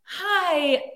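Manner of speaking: a high, nervous fan greeting. Retold or imitated. A starstruck fan greets Keanu Reeves, high and nervous. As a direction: chirp it up to your idol. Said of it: "So I walk in and I'm like".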